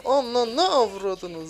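stretched-out exasperated curse; drawn-out curse. Real, not acted. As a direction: drag out every syllable of "avradını" loud. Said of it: "repeats it drawn out".

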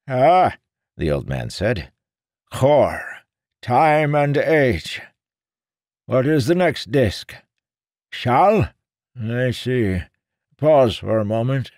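put-on high, slow mystic old man; old seer voice. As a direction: intone like an old fortune-teller. Old seer reading chips.

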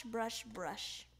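ordinary delivery, nothing marked

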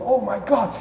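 high voice for a thrilled dog; acted giddy pet; excited dog's voice. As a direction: play the dog bursting for a walk. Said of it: "So your dog is gonna go".

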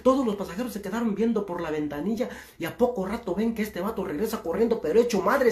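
usual speaking voice

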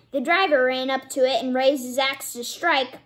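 ordinary delivery

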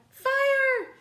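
high, loud alarmed cry of fire; frightened shout. Acted. Animals warning Wombat of fire, loud alarmed cry. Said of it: "they all cried".

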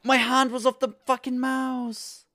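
strained high furious complaint; frustrated outburst. Real, not acted. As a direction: shout in disbelief at your own mistake.

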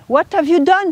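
high-pitched retold reproach; imitating a stern, challenging question; blunt rebuke. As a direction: confront without any politeness. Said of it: "is not a matter of politeness".

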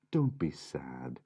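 soft whispered comfort. Acted. Mum soothing her sad boys in a whisper. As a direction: hush them gently.

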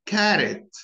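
"Carrot" with an American flat a; American pronunciation. Shown as a demonstration. Teacher showing learners the American accent. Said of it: "notice the differences between them".

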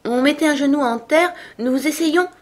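high-pitched, meek explanation; very humble. A narrator's reading. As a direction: explain humbly to a feared sovereign. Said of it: "d'une voix très humble".

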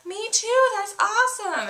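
a hint of fake girly enthusiasm; high mock kid voice. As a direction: chirp it back sweetly. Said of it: "And I was like".

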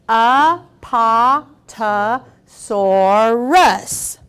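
Apatosaurus drawn out slowly, each syllable apart. Shown as a demonstration. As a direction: count out Apatosaurus beat by beat. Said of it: "Say it with me and count".

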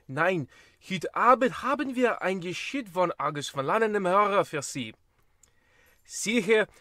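high, loud hammed-up German announcer; German host. German host greeting the listeners, hammed-up loud German. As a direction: boom out the German welcome, overdone. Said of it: "the German one".

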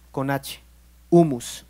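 "humus" dicho más fuerte y marcado; énfasis en humus. Shown as a demonstration. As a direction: recalca bien "humus". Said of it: "la palabra humildad viene de humus". ("humus" remarcado con fuerza)

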